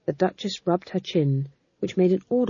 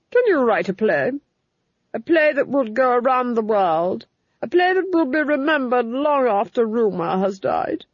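lightly voiced, insistent duchess; commanding duchess; 5 semitones higher than usual. A narrator's reading. Duchess ordering the Fool to find a playwright, insistent. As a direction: demand it of the servant.

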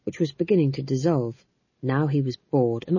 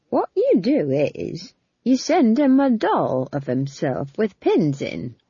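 put-on higher, slow eager old woman; eager old witch; 4 semitones higher, slower than usual. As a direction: suggest it eagerly like a gossipy witch. Nanny Ogg eager voice.

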